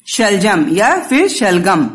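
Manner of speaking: slow शलजम then शलगम, clearly marking ज versus ग; both pronunciations. Shown as a demonstration. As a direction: carefully model both spellings side by side. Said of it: "ग और ज दोनों ही उच्चारण किया जाता है".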